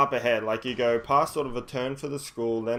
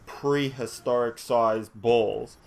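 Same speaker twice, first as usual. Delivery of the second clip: usual speaking voice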